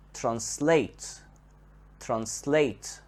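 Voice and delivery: "translate" with SLATE stressed and drawn out; stress on second part. Shown as a demonstration. Teacher shows learners the verb, second part of "translate" stressed. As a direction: lean on the end of "translate": tran-SLATE. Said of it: "the stress here is on the second part".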